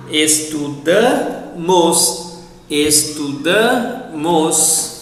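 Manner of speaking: very slow "Estudamos", weight on "da", then "mos" apart; syllable-by-syllable "Estudamos", long stressed "da"; stress on penultimate syllable. Shown as a demonstration. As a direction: lean on the "da" of "Estudamos". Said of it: "stress on the penultimate syllable".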